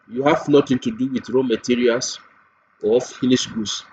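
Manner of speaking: ordinary talk, nothing marked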